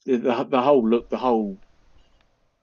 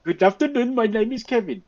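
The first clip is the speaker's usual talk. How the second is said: high, mock Indian accent call centre greeting; 9 semitones higher than usual. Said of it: "talking in an Indian accent?".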